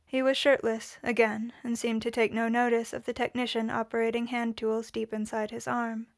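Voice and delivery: ordinary delivery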